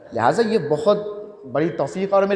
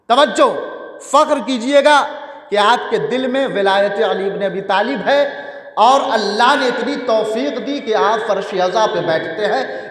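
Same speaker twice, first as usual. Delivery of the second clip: ordinary delivery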